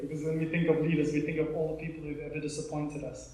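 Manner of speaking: ordinary delivery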